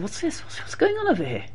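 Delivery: high, quiet puzzled questioning; softly baffled at a strange discovery; hushed astonishment. Acted. Rabbi discovers the hidden priest's clothing.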